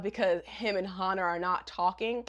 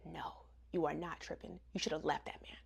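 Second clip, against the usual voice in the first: low, much softer grumble; muttered aside; 7 semitones lower, 16 dB quieter than usual. Real, not acted. Vlogger scolding Agatha under her breath, low and much softer.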